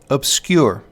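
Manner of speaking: "obscure" with a light first syllable, stressed second; iambic stress. Shown as a demonstration. Lecturer giving an example of iambic rhythm, "obscure" stressed on "scure". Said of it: "hear the rhythm of the iamb".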